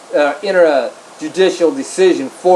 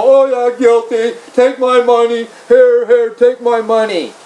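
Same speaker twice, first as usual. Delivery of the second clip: higher, mock-eager pushover voice; mocking a meek defendant; 5 semitones higher than usual. Mocking viewers who plead guilty in court, higher mock-meek voice. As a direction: sneer at people who cave in court. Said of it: "You're all gonna go".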